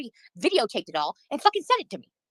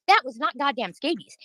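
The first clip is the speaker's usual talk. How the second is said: ordinary delivery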